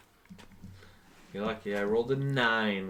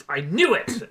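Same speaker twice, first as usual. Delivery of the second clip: sudden loud high shout of triumph; angry outburst; 12 semitones higher, 6 dB louder than usual. Acted. Governor bursting out to the ambassadors, loud high shout. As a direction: explode with it, fist on the table.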